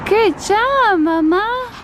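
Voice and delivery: high, loud delighted praise; excited praise. Real, not acted. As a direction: cheer the little one on. Praising toddler's throw.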